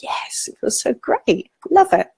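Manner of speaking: blissful yes. Acted. Voicing pleasure at chocolate compassion.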